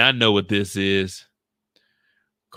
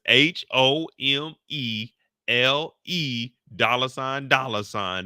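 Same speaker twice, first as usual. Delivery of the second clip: slow letter-by-letter spelling, x0.6; spelling a new name letter by letter, slowly; slower than usual. Shown as a demonstration. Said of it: "spelling the new name".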